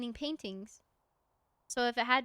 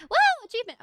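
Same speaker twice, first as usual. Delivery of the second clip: high loud cheer of victory; excited whoop; 12 semitones higher, 11 dB louder than usual. Real, not acted. Gamer cheering an unlocked achievement, whooping. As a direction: whoop it like a win.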